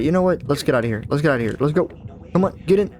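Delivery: normal talking voice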